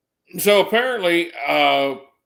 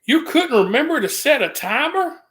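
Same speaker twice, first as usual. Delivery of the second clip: high, exasperated imitating of the boss; mock her disbelief; scolding boss; 10 semitones higher than usual. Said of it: "the first thing she says".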